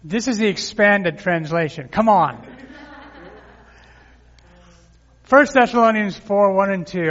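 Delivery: revved-up pulpit announcement; preaching. Real, not acted. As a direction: fire up the room before the verse. Pumping up the congregation.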